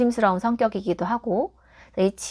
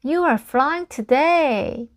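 louder-edged, raised, slow storybook exclamation; higher and drawn out, lightly acted amazement; Gerald's line; 5 semitones higher, 3 dB louder, much slower than usual. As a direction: call out the character's line slowly, pitched up. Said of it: "제럴드가".

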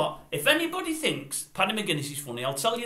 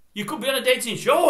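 ordinary talk, nothing marked

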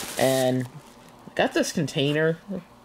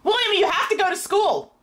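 high raised voice; yelling across the house; 12 semitones higher than usual. Real, not acted. Arguing upstairs with son.

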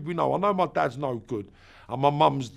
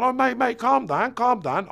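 high, louder, pleading mate calm down; placating geezer; 9 semitones higher, 4 dB louder than usual. Retold or imitated. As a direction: urgently try to calm an angry dad. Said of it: "The geezer's even gone".

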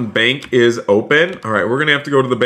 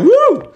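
high, loud "woo" cheer; excited whoop; 5 dB louder than usual. Real, not acted. Gamer celebrating getting Mister Cheese out of jail, excited whoop.

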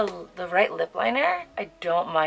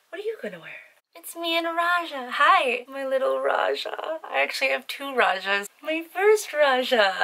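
cooing to a toy; 7 semitones higher than usual. Real, not acted. Showing her toy tiger to viewers, high tender coo. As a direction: coo at the stuffed tiger like a pet.

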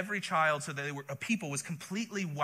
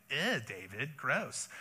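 softer, wrinkled-nose mock disgust; 4 semitones lower, 5 dB quieter, slower than usual. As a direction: say it like something smells bad. Pastor mimics Nabal's disgust.

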